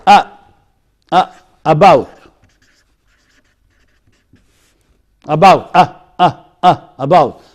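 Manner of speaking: "Uh" as a short neutral vowel, then "about"; schwa sound. Shown as a demonstration. Teacher demonstrating the schwa, short "Uh" as in "about". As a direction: isolate the schwa "Uh" that begins "about". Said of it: "What about the شوا?".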